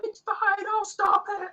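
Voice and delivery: very high, sweet voice; a tiny high voice insisting it's all right; high reassuring voice. Acted. Ship voice calls the player back, very high reassurance.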